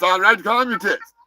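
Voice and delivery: ordinary talk, nothing marked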